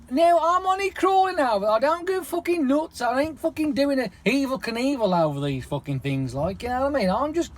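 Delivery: indignant rant at the humps; worked-up rant. Real, not acted. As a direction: wind yourself up about the humps. Worked-up rant about road humps.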